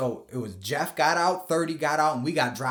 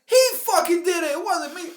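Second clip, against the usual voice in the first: high, loud mock voice pointing at someone else; blaming; 6 dB louder than usual. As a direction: shift the blame in a high voice. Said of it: "And I said".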